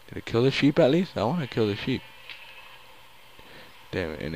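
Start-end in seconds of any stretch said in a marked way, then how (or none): 0.1-2.0 quick, high, eager; excited. Real, not acted. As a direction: blurt it out fast. Excited mid-fight.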